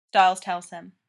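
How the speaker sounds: ordinary delivery, nothing marked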